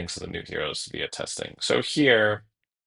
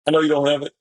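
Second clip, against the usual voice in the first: taunting; 7 semitones higher, 6 dB louder, much faster than usual. Pretend. Taunting the opponent's deck.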